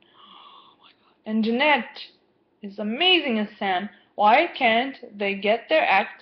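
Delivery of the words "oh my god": whispered gasp. Real, not acted. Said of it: "gasps at the TV".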